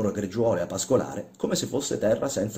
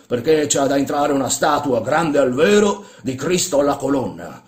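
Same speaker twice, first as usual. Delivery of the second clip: louder, higher, emphatic instructions; commanding old master; 5 semitones higher, 7 dB louder than usual. Acted. Old man describing his order to a workman, commanding. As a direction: lay out the plan forcefully.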